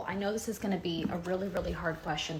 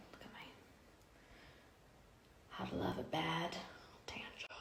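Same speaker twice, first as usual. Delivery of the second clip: whispered aside about her tan job; 18 dB quieter than usual. Real, not acted. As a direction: drop to a secret whisper.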